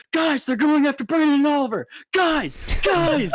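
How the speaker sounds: imitated panicked shouting to the bouncers; retold scream for help. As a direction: yell urgently into the mic. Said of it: "I'm screaming into the mic".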